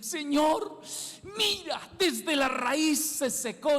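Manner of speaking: high, astonished exclamation of the disciples; awed followers pointing at the dried-up tree; amazed disciples. Retold or imitated. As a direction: gasp it out as if you can't believe the tree withered.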